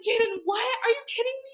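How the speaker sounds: loud, very high mock shriek; retold shocked shriek. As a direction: squeal it as the stunned best friend. Friend's shocked reaction, retold.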